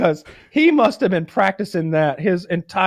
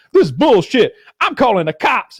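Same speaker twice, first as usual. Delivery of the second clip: raised, louder indignant protest; outraged working Joe; 5 semitones higher, 4 dB louder than usual. Retold or imitated. Working Joe who saw the attack, acted outrage. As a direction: object loudly, acting fed up. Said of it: "actual working Joe ... goes".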